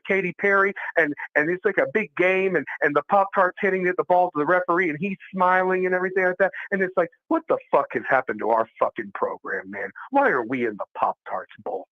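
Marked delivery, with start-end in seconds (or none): none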